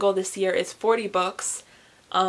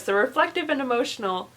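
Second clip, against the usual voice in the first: ordinary delivery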